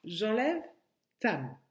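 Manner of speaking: ordinary talk, nothing marked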